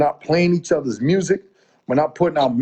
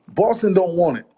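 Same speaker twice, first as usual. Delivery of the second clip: normal talking voice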